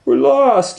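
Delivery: very high, loud lost cry; scared wail. Acted. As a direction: blurt it out upset. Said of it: "Sheila Ray said".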